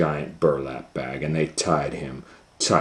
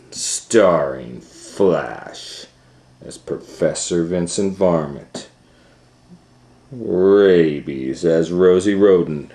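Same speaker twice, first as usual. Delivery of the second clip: trailer announcer; 5 dB louder than usual. Acted. Parent reading cast credits to Leo, grand trailer voice. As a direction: draw out each name like a premiere.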